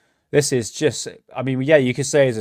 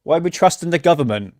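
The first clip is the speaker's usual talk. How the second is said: ordinary delivery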